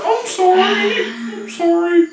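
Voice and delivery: high, slow, whiny sorry; high slow apologetic voice. Retold or imitated. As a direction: drag it out high and pleading. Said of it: "Jenna's like".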